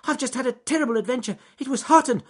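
high, loud, fast rush of words; anxious rabbit. Acted. Rabbit rushes to tell the bear his adventure, high and fast. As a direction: gabble it out in a high hurry. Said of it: "anxious to tell his friend".

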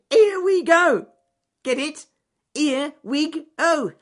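very high and loud, giddy; gleeful high rabbit. A narrator's reading. Alec explaining his own joke, giddy high squeal. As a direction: squeal it out, delighted with himself.

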